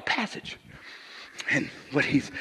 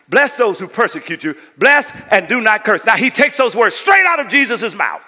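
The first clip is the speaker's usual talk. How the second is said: preaching scripture; 7 semitones higher, 12 dB louder than usual. Acted.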